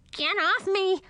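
high squeaky indignant complaint; squeaky annoyed bat. Acted. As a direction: squeal it out, squashed and cross. Lil squashed under Emma.

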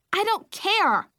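high, loud tiny-tot snap; a little girl digging in; defiant toddler. Acted. Angry toddler voice in story.

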